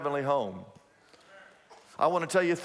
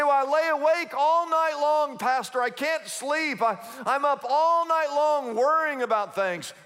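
sleepless worrier; 7 semitones higher than usual. Retold or imitated. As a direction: plead with the pastor like someone up all night. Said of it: "You say".

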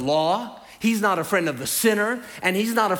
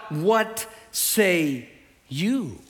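slow challenge; much slower than usual. Acted. Pharisees questioning Jesus.

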